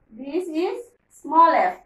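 very slow, spelled-out teaching voice; slow teacher model. Shown as a demonstration. Teacher showing the small letter, very slow. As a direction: draw out each word slowly.

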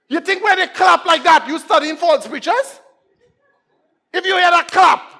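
high, loud challenge to the congregation; impassioned high-pitched preacher's shout; full-force preaching. Real, not acted. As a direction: shout the warning from the pulpit.

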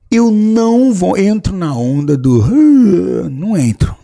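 a sceptic's rising, heated protest; emphatic outburst. Real, not acted. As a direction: push it out like you're fed up with mystic talk. Insisting he's a sceptic.

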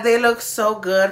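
normal talking voice

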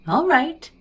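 airy, high-pitched careless reply. A narrator's reading. Sally gives up on petting the dog, careless. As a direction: toss it off high and loud. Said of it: "she answered carelessly".

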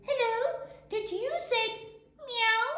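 high-pitched little goat asking; little kid goat. A narrator's reading. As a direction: ask in a high baby-animal voice. Kid asks the sheep.

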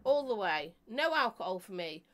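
ordinary talk

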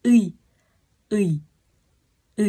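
Ư held and repeated; teacher's Ư; Korean vowel ư. Shown as a demonstration. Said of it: "Ghép như tiếng Việt vậy đó".

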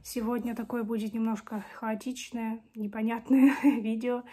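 ordinary talk

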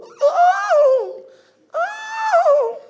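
very high, loud, falsetto-like mock sweet voice; mock sweet singer voice. Mimicking a singer on stage, overly sweet and soft. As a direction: ham up a singer's sugary stage voice. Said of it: "sounds so sweet and soft".

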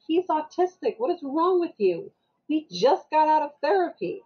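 high, indignant retold telling-off; exasperated scolding.

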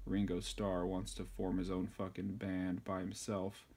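ordinary talk, nothing marked

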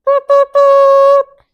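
high, loud honking horn; car horn honks. A narrator's reading. Emmy honking at her friends, a high loud horn. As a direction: beep it like a little car horn. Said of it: "Emmy honked her horn".

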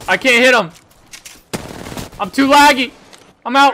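loud annoyed gamer gripe; frustrated shout. Real, not acted. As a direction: complain loudly about lag. Frustrated complaint in fight.

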